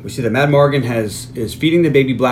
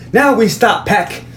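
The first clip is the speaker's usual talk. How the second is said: mocking; 8 semitones higher, slower than usual. Quoting character mockingly.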